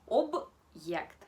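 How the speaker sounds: loud model of Object with the hard sign; correct hard-sign word. Shown as a demonstration. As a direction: Object said loudly with a hard b and a clear break before the ye. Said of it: "lo pronunciamos así".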